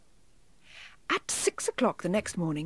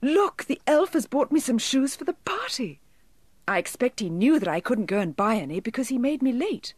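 put-on high-pitched, eager child with news; bubbling, higher-pitched young girl's excitement; excited cry; 5 semitones higher, 4 dB louder than usual. A narrator's reading. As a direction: burst out with thrilling news for mother. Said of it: "she cried".

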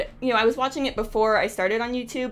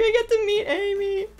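excited squeal; 11 semitones higher, 3 dB louder than usual. Retold or imitated. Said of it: "I was like".

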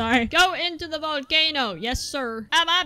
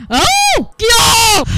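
high, loud startled cry; alarmed shout; 7 semitones higher, 9 dB louder than usual. Real, not acted. Gamer reacting to danger, high alarmed shout. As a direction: yell out in sudden alarm.

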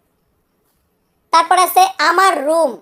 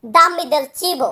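Dammi del cibo said very slowly, word by word; slow it down for learners to follow; careful, slow model pronunciation; slow teaching model; much slower than usual. Shown as a demonstration. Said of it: "তাহলে আমরা বলতাম".

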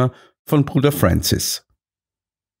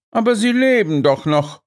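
matter-of-fact; 11 semitones higher than usual. A narrator's reading. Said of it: "stellte Holmes nüchtern fest".

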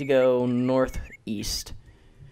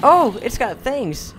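high, slow, thrilled repeat; excited; 10 semitones higher, 8 dB louder, slower than usual. Real, not acted. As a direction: gush over it once more. Repeats the delighted line.

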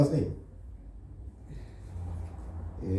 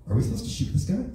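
higher, baffled soldier voice; lightly voiced uncertain soldier; puzzled soldier; 10 semitones higher than usual. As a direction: ask it like a confused grunt.

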